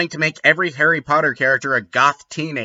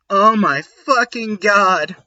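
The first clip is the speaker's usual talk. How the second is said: higher, louder mock swoon; hammed-up gasp; 7 semitones higher, 4 dB louder, slower than usual. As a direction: overact a swooning goth girl. Narrator gasp in reading.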